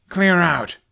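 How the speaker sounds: high, loud, angry snap; furious command; snapped order. A narrator's reading. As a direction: bark them out of the way. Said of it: "Hiccup snapped".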